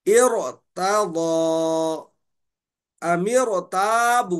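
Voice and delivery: इरतादा, अमीरताबू very slow, ra thick; heavy ra. Shown as a demonstration. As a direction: model each word with a heavy ra. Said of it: "रा को मोटा पढ़ेंगे". (मोटा रा का नमूना)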